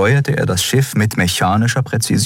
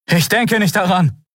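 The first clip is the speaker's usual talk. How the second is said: much higher, lightly voiced young man; Kai's refusal; 14 semitones higher than usual. Kai refuses Kero over his shoulder, higher voice. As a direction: refuse while running off.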